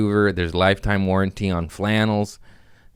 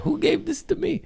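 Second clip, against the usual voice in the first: squeaky falsetto, much higher, puzzled; confused mock question. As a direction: play it dumb and baffled, way up high. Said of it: "and not be like".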